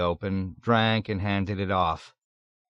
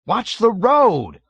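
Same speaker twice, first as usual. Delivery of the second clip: high, slow, loud scream; terrified cry at the driver; panicked yell; 12 semitones higher, 9 dB louder, slower than usual. Acted. Passenger screaming at the driver who turned around, panicked.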